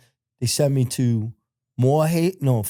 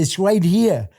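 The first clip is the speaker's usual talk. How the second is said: higher and louder, insisting; insistent; 7 semitones higher, 5 dB louder, slower than usual. Retold or imitated. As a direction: correct the dentist firmly. Arguing with dentist about tooth.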